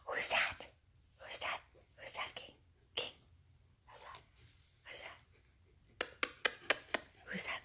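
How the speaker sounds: very soft, whispered coaxing; hushed teasing questions to a pet; excited whisper to dog. Real, not acted. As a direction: whisper to get the dog's ears up.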